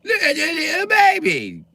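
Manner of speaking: put-on very high, slow, loud child's voice; high little girl. A little girl begging to hold a baby, very high voice. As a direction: coo over and over like a stuck toy.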